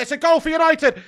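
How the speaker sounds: goal celebration. Real, not acted. Fan yelling at the screen as United score, ecstatic shout. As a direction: explode with joy at the goal.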